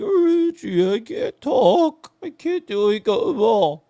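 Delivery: high, strained croaky friend with no voice; imitating hoarse TD. Mocking the voiceless friend on the phone, high and strained. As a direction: squeak it out like your voice is gone. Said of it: "He was like".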